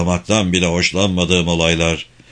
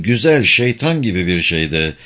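ordinary delivery, nothing marked

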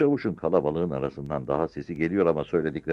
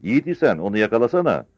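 high, raised dare; shouted challenge; 7 semitones higher, 6 dB louder, faster than usual. A narrator's reading. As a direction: goad the officer loudly. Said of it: "diye bağırdı Sarı Çavuş". (bağırarak meydan okuyan)

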